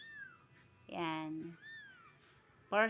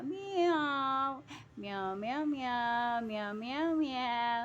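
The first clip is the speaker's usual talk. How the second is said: singsong chain of human meows; playful mock kitten meowing; imitated cat meows; much slower than usual. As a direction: meow along like a kitten, over and over.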